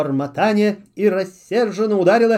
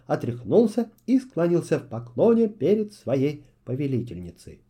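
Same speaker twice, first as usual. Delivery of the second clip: usual speaking voice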